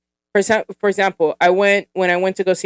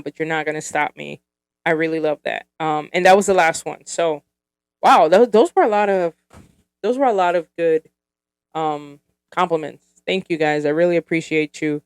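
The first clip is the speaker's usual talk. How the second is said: ordinary talk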